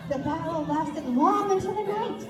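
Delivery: ordinary delivery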